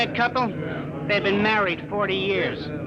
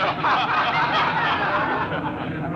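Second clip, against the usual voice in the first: airy laugh after the punchline; chuckle at own joke. Acted. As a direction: laugh as if the joke was great.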